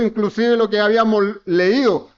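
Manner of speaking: ordinary delivery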